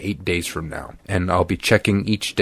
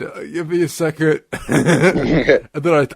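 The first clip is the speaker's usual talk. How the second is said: high pinched voice through a laugh; squeezed, pitched-up reply; weird strained voice; 5 semitones higher, slower than usual. Retold or imitated. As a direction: talk as if your nose was just jabbed. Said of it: "I literally talk like this".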